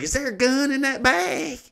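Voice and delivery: high-pitched, strained demand; mock bailiff yell. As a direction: imitate an alarmed bailiff without full volume. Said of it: "he's yelling at this lady".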